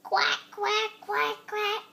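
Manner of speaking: duck quack. Acted. Child imitating a duck.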